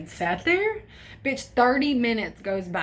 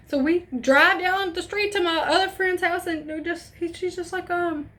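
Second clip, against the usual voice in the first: usual speaking voice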